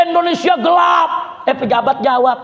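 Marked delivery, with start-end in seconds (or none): 0.1-1.1 high protest shout; students shouting. Retold or imitated. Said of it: "Mahasiswa teriak".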